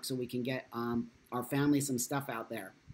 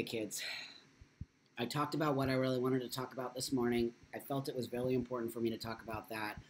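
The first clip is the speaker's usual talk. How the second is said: usual speaking voice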